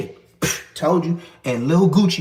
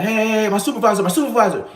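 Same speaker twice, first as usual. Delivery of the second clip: quick, high hurried alert to break it up; urgent warning; 6 semitones higher, 4 dB louder, faster than usual. Retold or imitated. Officer urgently warns inmates the supervisor is coming, quick and high. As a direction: hiss out a fast heads-up, pitched up.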